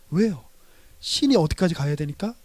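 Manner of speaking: usual speaking voice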